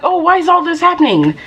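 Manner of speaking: high mock whine. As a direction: mimic a clueless whiner, pitched high. Mocking clueless people.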